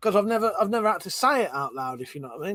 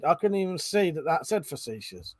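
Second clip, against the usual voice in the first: ordinary talk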